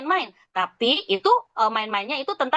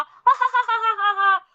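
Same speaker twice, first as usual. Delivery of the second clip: acted laugh; 9 semitones higher, much slower than usual.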